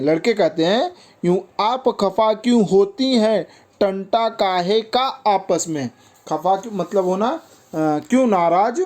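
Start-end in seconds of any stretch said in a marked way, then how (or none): 1.2-5.9 higher-pitched, measured verse; poem recitation. Acted. Boys asking why there is any quarrel, poem recitation.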